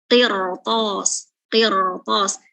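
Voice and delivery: "Qirtos" with heavy velarized q and r, lips not pushed forward; thick tafkhim. Shown as a demonstration. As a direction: say "qirtos" thick from the back of the tongue. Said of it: "bacanya tebal tafkhim". ("qirtos" dibaca tebal, tafkhim, bibir tidak maju)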